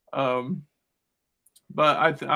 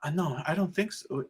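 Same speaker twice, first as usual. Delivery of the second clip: put-on girls debating; 3 semitones higher than usual. Girls deciding the scarecrow is fake, imitated in higher voices. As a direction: mimic overheard chatter, pitched up. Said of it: "I heard their conversation".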